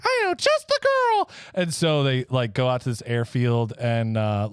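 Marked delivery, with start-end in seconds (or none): 0.0-0.9 tiny, high cookie voice; little voice. Acted. Gingerbread man popping out of the shadows, tiny high voice. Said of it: "Gingerbread Man is like".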